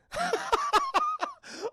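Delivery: very high-pitched laughing fit. Retold or imitated. Streamer cracking up over the Batman call, high laugh. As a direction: burst into a high cackle. Laughs while acting out phone reaction.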